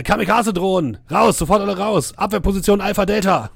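high, loud shouted alarm; yelled orders. Acted. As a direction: bellow the alarm, all out. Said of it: "dass in die Baracke reingebrüllt wird".

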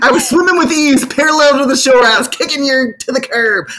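high, loud, gleeful mock boast; playful boasting. Swimmer bragging about kicking validation aside, playfully exultant. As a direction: crow about it, pitched well up.

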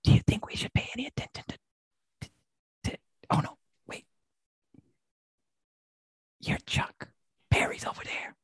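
breathy secret whisper. Acted. Whispering, noticing watcher.

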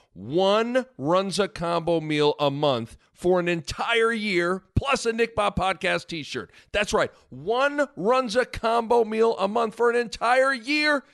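big reveal, building up; booming prize announcer. Acted. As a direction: announce the grand prize with hype. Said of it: "wait for it".

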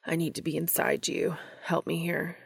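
urgent, whispery gasp. A narrator's reading. Cass pulling back from a kiss, gasping out. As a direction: gasp it out with hardly any voice. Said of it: "gasping out".

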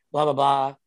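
ordinary delivery

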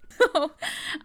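high burst of real laughter; amused laugh. Real, not acted. Host at friend's gross sniffing remark, high laugh.

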